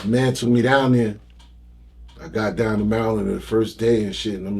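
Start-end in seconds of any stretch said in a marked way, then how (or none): none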